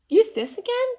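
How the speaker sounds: high, loud playful question; mock surprised question. Answering the doll's pretend request, high and playful. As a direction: pretend you heard the doll ask. Pretend hearing the doll speak.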